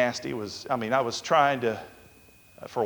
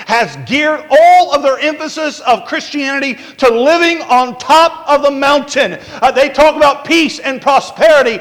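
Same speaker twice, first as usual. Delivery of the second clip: higher, driving pulpit delivery; forceful preaching; 7 semitones higher, 5 dB louder than usual. Real, not acted. Preacher decrying prosperity religion to congregation, impassioned and higher. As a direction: press each point home in rising sermon cadence.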